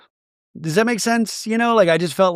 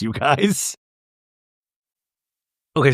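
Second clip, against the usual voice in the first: ordinary talk, nothing marked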